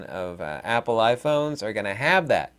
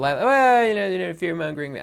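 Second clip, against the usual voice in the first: higher, louder, fast dismissive critic voice; mock critic; 6 semitones higher, 4 dB louder than usual. Vlogger imitating a commenter dismissing him, higher and fast. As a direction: brush it off like a scoffing commenter. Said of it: "I don't make this video li".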